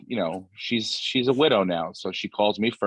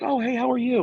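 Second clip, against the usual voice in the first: imitating mom. Son voices his mom.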